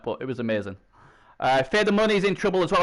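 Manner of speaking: ordinary delivery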